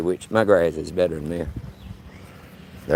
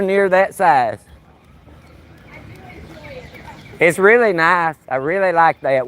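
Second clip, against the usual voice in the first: higher and louder, calling across the yard; friendly call-out; 8 semitones higher, 4 dB louder than usual. Real, not acted. Calling to the lady on her porch, cheerful and loud.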